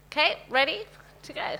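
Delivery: normal talking voice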